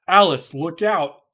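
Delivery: loud, slow warning cry; alarmed shout. Acted. Perry warning Alice as the fortress shakes, shouting. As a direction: yell the warning over the quake.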